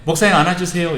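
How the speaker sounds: lightly voiced child, high and loud; child's request. Shown as a demonstration. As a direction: ask eagerly, a hint of a little kid. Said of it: "우리 어린 친구들 목사님 보면". (아이처럼 살짝 높고 큰 소리로 조르듯)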